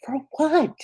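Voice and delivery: high, bewildered "For what?"; incredulous. Retold or imitated. Answering a friend who apologizes for crying, incredulous. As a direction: pitch it way up in disbelief. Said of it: "And I'm like".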